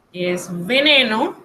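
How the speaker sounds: usual speaking voice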